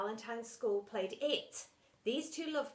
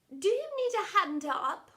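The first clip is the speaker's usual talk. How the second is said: much higher, louder put-on woman's voice; lady teacher voice; 9 semitones higher, 7 dB louder than usual. Miss Dovey asking kneeling Mister Lovey, high put-on voice. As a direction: ask sweetly and eagerly.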